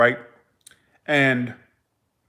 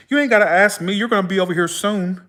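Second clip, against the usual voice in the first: retold emphatic warning; 6 semitones higher than usual. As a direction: brush the question off emphatically, pitched up. Dead great-aunt's retold sharp warning.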